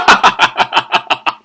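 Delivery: long run of evenly spaced ha-ha-has; drawn-out chuckling cackle; staccato laugh. Pretend. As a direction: laugh in a steady machine-gun rattle. Laughs after drinking remark.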